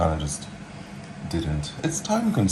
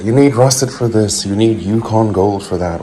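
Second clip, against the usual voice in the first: louder, mock-bossy quote of the potato rules; parroting fussy advice with a roll of the eyes; mocking know-it-alls; 3 semitones higher, 8 dB louder than usual. Cook mocks people who insist on certain potatoes, loud and bossy. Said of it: "whatever the people say".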